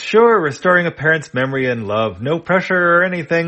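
high, loud mock-casual sarcasm; wry overplayed nonchalance; sarcastic. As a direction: joke about the huge pressure. Chone jokes about big request.

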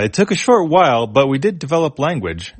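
a small robot mouse pleased with itself; robot mouse Leb. Acted.

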